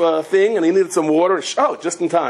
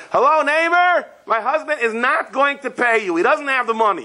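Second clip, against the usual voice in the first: high-pitched call up to a neighbor, no real volume; mock yell upstairs; 9 semitones higher than usual. Wife to upstairs neighbor out the window, mock yelling. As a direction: imitate a wife hollering out the window. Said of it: "she yells up".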